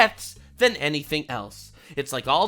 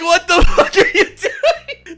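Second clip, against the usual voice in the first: slow, much louder, laughing outburst; laughing disbelief; 12 semitones higher, 12 dB louder, slower than usual. Retold or imitated. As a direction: bark it through a laugh. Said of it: "He's like".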